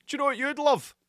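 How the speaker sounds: high, put-on tempting voice; wrestling brain's voice. Wrestling brain interrupting a sick man, high put-on voice. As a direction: pipe up like an eager inner voice. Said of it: "the wrestling part of my brain comes out and is like".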